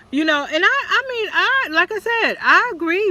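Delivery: getting worked up, high and loud; excited. Real, not acted. Excited rant, pitch jumps up.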